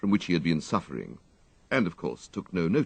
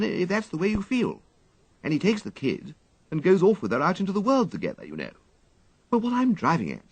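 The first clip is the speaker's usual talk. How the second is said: higher, rambling upper-class storytelling; eagerly recounting a play to his valet; breezy wistful gentleman; chatty young toff; 9 semitones higher than usual. A narrator's reading. Wooster's voice in story.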